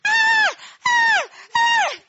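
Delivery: piercing high shrieks of joy; screaming with joy. Retold or imitated. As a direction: shriek like hearing wonderful news. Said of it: "That was Giselle in the background".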